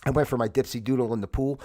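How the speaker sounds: ordinary talk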